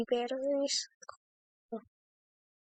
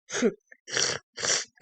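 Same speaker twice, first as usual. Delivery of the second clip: breathy nervous laughter; 4 dB louder than usual. Real, not acted. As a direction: laugh from fear. Nervous laugh while hunted.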